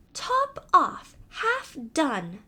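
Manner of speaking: a musing mouse repeating the names. Acted. Mouse recalling the odd names, high musing voice.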